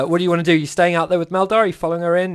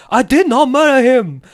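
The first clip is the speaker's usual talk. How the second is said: put-on loud, higher odd accent, DID punched; weird accent impression; 8 semitones higher, 7 dB louder than usual. Quoting the interrogated robot, slamming the table in weird accent. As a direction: slam the line in a strange accent. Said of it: "the weirdest accent ever".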